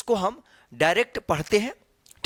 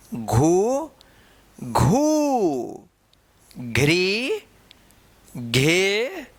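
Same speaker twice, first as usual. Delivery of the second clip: recitation drill; 2 semitones lower, much slower than usual. Shown as a demonstration. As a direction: drill the syllables one by one for students. Recites barakhadi syllables.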